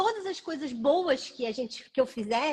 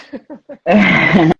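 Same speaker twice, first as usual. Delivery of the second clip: short laugh; 6 semitones lower, 15 dB louder than usual. Real, not acted. Laugh before answering question.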